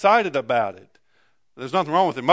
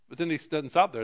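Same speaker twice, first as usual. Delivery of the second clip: ordinary talk, nothing marked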